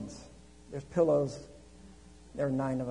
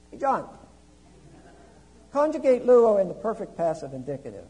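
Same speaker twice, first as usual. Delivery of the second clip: teasing challenge; 6 semitones higher, 11 dB louder than usual. Retold or imitated. Quizzing the student loudly.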